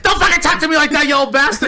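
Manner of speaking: high, loud put-on yell; mock teen shouting back. Peter yelling back at his old uncle, loud mock fury. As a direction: snap back like a furious teenager.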